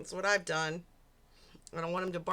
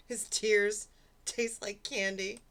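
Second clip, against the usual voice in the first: ordinary delivery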